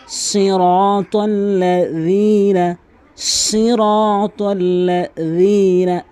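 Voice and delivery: chanted সিরাতাল্লাযীনা with th-as-in-this before long i; soft dhal. Shown as a demonstration. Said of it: "দালের উচ্চারণ". (সিরাতাল্লাযীনা-তে যাল, সুরে টেনে)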